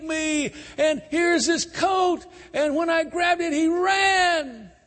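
false accusation. Retold or imitated. Wife screaming false charge at servants, high and shouted. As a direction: cry out a lie to the household men.

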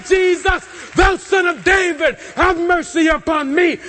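high-pitched shouted plea. Retold or imitated. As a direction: cry out over those silencing you. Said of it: "begins to cry out the louder".

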